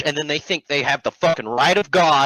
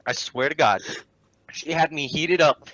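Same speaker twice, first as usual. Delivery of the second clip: ordinary delivery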